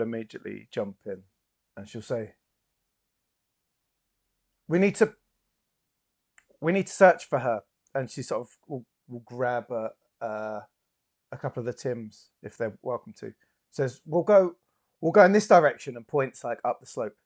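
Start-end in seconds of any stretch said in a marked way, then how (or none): 4.7-7.6 raised, higher in-character voice; rally people to start a search; urgent, louder call to action; urgent appeal. Acted. Character rallies a search party. 14.1-16.0 louder, higher commanding voice; giving urgent directions. Acted. Character directs the search party.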